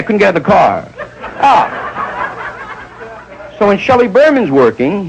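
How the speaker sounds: usual speaking voice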